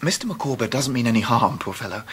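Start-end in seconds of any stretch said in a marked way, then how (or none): none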